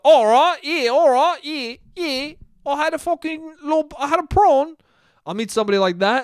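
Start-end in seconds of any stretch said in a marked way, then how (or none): 0.0-4.7 high, sing-song fake British-Australian accent; hammed-up, badly faked British-Australian dude; muddled mock accent. Foreign dude chatting to a stranger, muddled British-Australian accent. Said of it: "But I'll meet people like".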